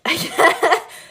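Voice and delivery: loud, high burst of laughter; bursting out laughing. Real, not acted. Reader failing at a stage whisper, bursting out laughing. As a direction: crack up helplessly.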